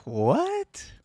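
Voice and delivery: normal talking voice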